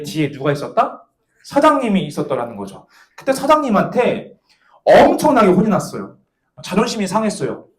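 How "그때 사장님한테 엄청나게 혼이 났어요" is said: normal talking voice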